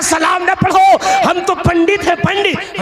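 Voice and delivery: indignant outburst. Retold or imitated. Pandit rebuking the mulla over salam, high indignant outburst. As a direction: exclaim it high and affronted.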